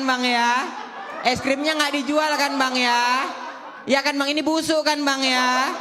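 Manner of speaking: bully the shopkeeper into agreeing; hammed-up yelling mother; shouting mom. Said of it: "mamaku teriak".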